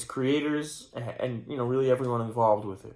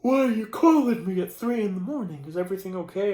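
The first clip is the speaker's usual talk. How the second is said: imitating a startled friend in a much higher voice; worried friend; 8 semitones higher, 5 dB louder than usual. Friend answering a call at three in the morning, alarmed and high. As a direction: play the friend woken at three, pitched way up and louder. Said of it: "And they'll say".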